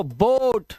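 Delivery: बोट with a held round "o"; first-vowel pronunciation. Shown as a demonstration. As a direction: model बोट as one syllable, "boht". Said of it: "क्या पढ़ रहे हो आप इसको?".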